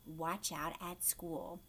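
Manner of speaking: ordinary delivery, nothing marked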